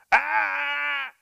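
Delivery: sharp high loud "Ah!" correction; corrective ah. Shown as a demonstration. Trainer correcting a barking puppy with a sharp loud ah. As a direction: bark out a sharp correction noise. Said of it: "that's the corrective vocalization".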